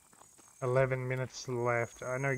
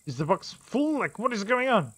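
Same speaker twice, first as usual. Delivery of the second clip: raised, higher exasperated questions; loud, higher disbelieving frustration; irate; 8 semitones higher, 6 dB louder than usual. Real, not acted. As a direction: vent at the broken game. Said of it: "I'm getting a little irate".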